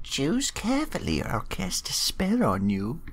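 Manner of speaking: high, put-on witch voice. Witch warning of a spell, witch voice. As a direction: threaten like a hammed-up witch. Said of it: "it actually sounded like a witch".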